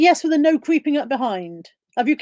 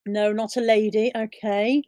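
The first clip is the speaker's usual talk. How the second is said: normal talking voice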